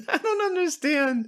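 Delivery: giggling disbelief; laughing. Real, not acted.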